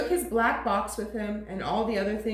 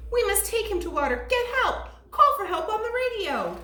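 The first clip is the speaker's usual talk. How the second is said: put-on urgent boy voice; 9 semitones higher than usual. Boy urging the policeman.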